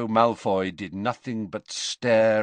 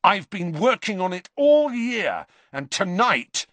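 rising boast; 11 semitones higher, 4 dB louder than usual. A narrator's reading. Malfoy boasting, cut off.

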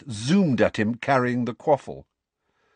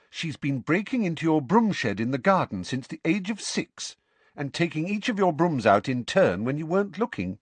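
Hermione voice; 7 semitones higher than usual. A narrator's reading. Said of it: "said Hermione".

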